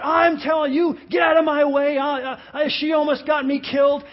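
loud, high mock yell of a livid driver; imitated angry yelling. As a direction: act out a furious man shouting someone down. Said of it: "And so he's yelling".

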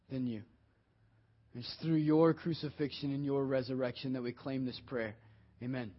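hushed pastoral prayer. Acted. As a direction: pray quietly and earnestly to Jesus. Opening prayer.

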